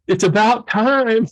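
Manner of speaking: high, drawn-out quote of a delighted wife; wife's joyful relief. Retold or imitated. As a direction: playfully imitate her finally-at-last cheer, breaking into laughter. Voices wife's reaction to his news.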